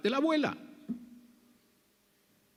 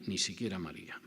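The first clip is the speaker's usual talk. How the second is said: "ni siquiera María" repeated lower and much softer; softer echo; 6 semitones lower, 8 dB quieter than usual. Real, not acted. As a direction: let "ni siquiera María" fall away quietly. Repeated phrase, dropped low.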